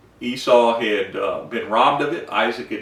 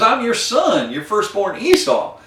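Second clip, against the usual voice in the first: eager; 9 semitones higher than usual. Retold or imitated. Esau eagerly announces himself.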